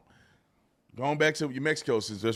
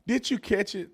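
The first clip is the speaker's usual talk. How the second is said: ordinary talk, nothing marked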